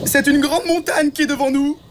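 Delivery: very high bird announcement; squawked high all through; blackbird character. Acted. Blackbird reports back to the troop, very high voice. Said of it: "annonça-t-il".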